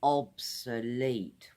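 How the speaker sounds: obsolete drawn out slowly, OB-so-lete; slow three syllables. Shown as a demonstration. Teacher modeling for students, slow syllabled obsolete. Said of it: "It's got three syllables".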